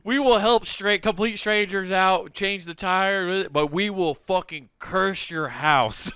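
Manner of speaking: high, emphatic, slow mock declaration; spiteful Midwesterner. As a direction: declare the grudge slowly, word by word.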